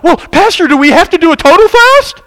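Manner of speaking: high, loud pleading with the pastor; whining congregant. Retold or imitated. Church member begging pastor for a lighter fast, loud whine. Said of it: "some people that did not like".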